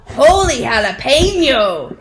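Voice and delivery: loud surprised cry of a little chihuahua; excited exclamation. Acted. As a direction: blurt it out in cartoon shock. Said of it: "exclaimed Skippy John Jones".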